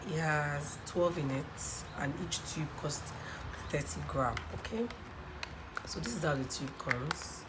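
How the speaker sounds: usual speaking voice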